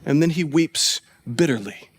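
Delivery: low, soft and sombre; grave, hushed. A narrator's reading. Preacher returns to Peter's bitter weeping, hushed and grave. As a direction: drop right down after the build-up.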